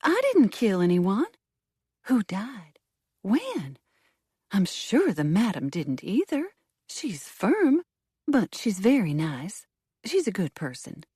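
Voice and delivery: high, rushed, frightened protest; flustered. A narrator's reading. Hostess defends herself and the madam, frightened and flustered. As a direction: blurt it out in alarm. Frightened woman denies murder.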